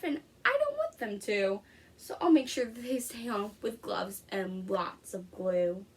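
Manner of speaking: lively picture-book read-aloud; reading to children. Acted. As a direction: read it to a class of young kids. Teacher reads picture book aloud.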